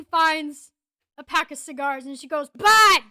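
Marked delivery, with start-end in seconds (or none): 2.5-3.0 sudden high, very loud shout; calling out. Acted. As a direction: holler it across to a buddy. Character calls out to the cleric.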